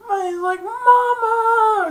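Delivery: high, drawn-out cry for mother; mock pained wail. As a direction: howl it like a kicked man. Kicked man cries for mother.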